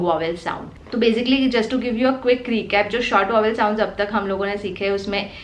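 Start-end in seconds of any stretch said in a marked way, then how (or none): none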